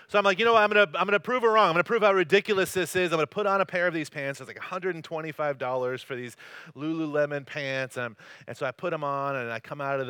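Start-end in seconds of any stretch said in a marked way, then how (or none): none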